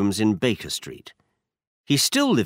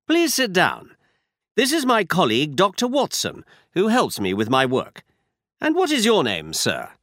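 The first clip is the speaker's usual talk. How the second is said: a slightly higher, brisk Holmes; Holmes, lightly voiced; 7 semitones higher than usual. A narrator's reading. Holmes instructing Watson, lightly voiced detective.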